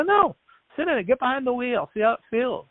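eager urging. Retold or imitated. Urging stranger to try car.

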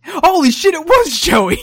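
loud, high burst of glee; delighted shout. Real, not acted. Cohost shouts in delight at the Joey voice. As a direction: shout out the discovery.

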